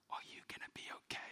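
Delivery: fast, secretive whisper leaning over the counter; stage whisper. Retold or imitated. Clerk asks wife about her husband, hushed stage whisper. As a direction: whisper it like a worried confidante. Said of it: "in a stage whisper".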